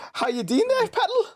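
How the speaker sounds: very high, singsong Geordie greeting; Geordie accent. Shown as a demonstration. Showing how locals up north talk, high broad Geordie. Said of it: "that's what a lot of us talk like".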